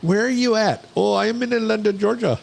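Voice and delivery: put-on high-pitched Indian accent; mock call-center Indian accent. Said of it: "in an Indian accent".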